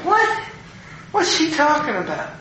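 high incredulous retold question; baffled. Pastor reacts to her second cryptic remark, baffled. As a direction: pitch it way up in mock bewilderment.

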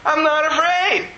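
high, fervent outpouring; retold happy bawling. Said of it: "why are you crying".

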